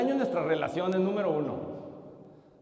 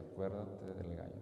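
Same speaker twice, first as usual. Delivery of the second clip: whisper; 11 semitones lower, 16 dB quieter than usual. A narrator's reading. Sister repeats her secret threat.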